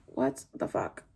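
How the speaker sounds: hushed unvoiced what the fuck; whispered disbelief. Teen recalls her reaction to threats, whispered. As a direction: breathe it out stunned. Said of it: "so I'm like".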